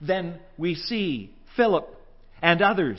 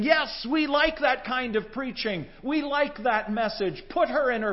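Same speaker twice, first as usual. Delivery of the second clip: eager agreement; 9 semitones higher, 3 dB louder than usual. Retold or imitated. Disciples chime in eagerly.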